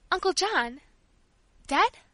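high, loud disbelieving question; stunned it's true; shocked. Acted. Sister shocked at the news.

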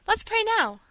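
high-pitched urgent urging; insistent. A narrator's reading. Girl urges the servant to pray, insistently. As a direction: press for it right now. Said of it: "insisted Paula".

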